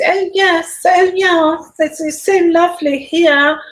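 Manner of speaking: high-pitched mock-regal royal voice; Queen's voice. Vlogger mimicking how the Queen talks, high regal impression. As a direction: put on a high, genteel Queen voice. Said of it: "the Queen talks".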